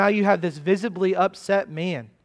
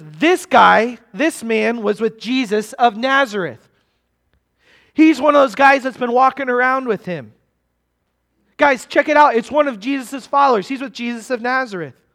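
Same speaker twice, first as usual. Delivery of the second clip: raised, insistent pointing-out; lightly acted accusation; 4 semitones higher, 5 dB louder than usual. Servant girl to bystanders about Peter, mildly urgent accusation. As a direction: call bystanders' attention to him.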